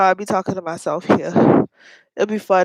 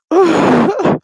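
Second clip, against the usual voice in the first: bright rising giggle; high laugh; 8 semitones higher, 6 dB louder than usual. Real, not acted. Laughs at own playback.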